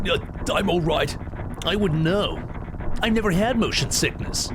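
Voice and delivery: high, youthful Cloud voice; young voice. Acted. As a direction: play him young and light. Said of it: "keep this young voice for Cloud".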